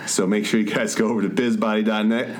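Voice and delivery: smooth radio host plug; warm announcer. Shown as a demonstration. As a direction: read the plug like a late night radio DJ. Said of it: "late night D J voice".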